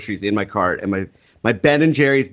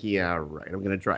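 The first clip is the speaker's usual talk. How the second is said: sarcastic mutter; 6 semitones lower, 10 dB quieter than usual. Retold or imitated. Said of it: "I'm like".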